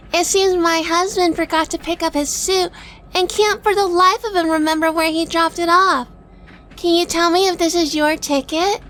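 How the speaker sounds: butter up the clerk; sweet wife. Acted. Narrator plays sweet wife.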